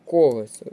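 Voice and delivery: Колесо punched loud on КО; Колесо as КО-лесо; stress on first syllable. Shown as a demonstration. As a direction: hit КО hard in Колесо. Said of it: "з наголосом на перший склад".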